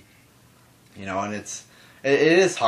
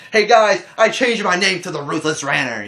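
hammed-up shout, much higher and louder; mock yell; 9 semitones higher, 8 dB louder than usual. Shown as a demonstration. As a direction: bellow the name change like a ranter. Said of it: "What do you want me to yell?".

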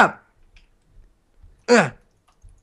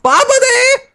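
silly squeal; 3 dB louder than usual. Real, not acted. Silly exclamation.

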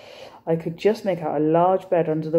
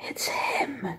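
gasped, breathless whisper; breathed whisper; 8 semitones higher, 8 dB quieter than usual. Acted. Boy sees the circus poster, awed whisper. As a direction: breathe it out in awe. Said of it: "he breathed".

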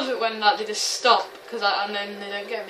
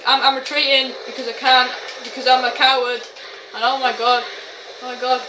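panicked retreat; 3 semitones higher, 7 dB louder than usual. Real, not acted. Retreating under fire, calls himself coward.

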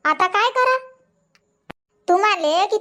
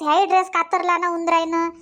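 usual speaking voice

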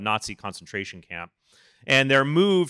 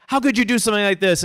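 mock angry protester; 9 semitones higher, 4 dB louder, faster than usual.

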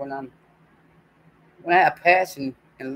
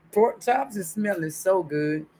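normal talking voice

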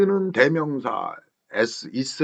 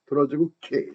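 ordinary delivery

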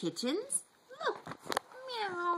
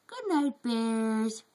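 usual speaking voice

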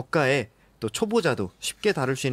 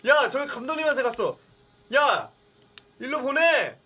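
yelling across the room; 10 semitones higher than usual. Real, not acted. Calling out, drone flew away.

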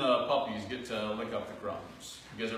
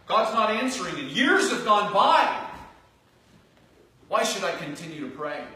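anguished cry; 7 semitones higher, 8 dB louder than usual. Retold or imitated. Imagined weary prayer of doubter.

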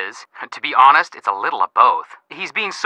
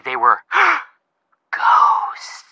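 mock spooky; 6 semitones higher, much slower than usual. Joking spooky suggestion.

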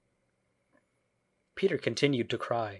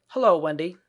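put-on louder, higher boyish greeting; carefree boy; 6 semitones higher, 8 dB louder than usual. A narrator's reading. Peter greets Wendy unaware of change, loud boyish voice. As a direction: call out as a heedless boy.

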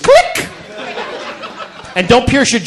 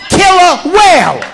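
slow, high, stretched out "Killer whale"; laughing, slow "Killer whale"; emphatic repeat; 7 semitones higher, 4 dB louder, slower than usual. Acted.

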